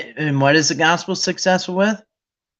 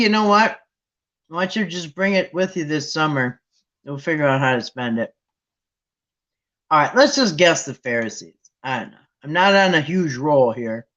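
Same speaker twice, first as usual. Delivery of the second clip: ordinary talk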